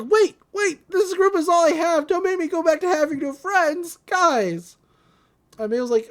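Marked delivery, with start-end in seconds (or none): 0.0-4.8 desperate pleading. Retold or imitated. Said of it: "Tom's like".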